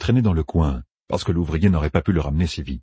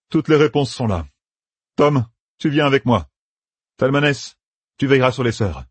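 put-on raised, higher young soldier giving orders; Matt's voice; 8 semitones higher than usual. A narrator's reading. Matt sends Tom and Talmanes off, commanding. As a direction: bark out orders to his companions.

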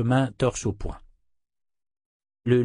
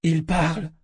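higher astonished exclamation; cri de stupeur; 6 semitones higher than usual. A narrator's reading. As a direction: blurt it out in amazement. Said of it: "s'écria-t-il". (exclamation stupéfaite)

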